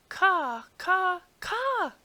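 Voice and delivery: high, loud crow caws. A narrator's reading. Returning crows cawing over the palace, high and loud.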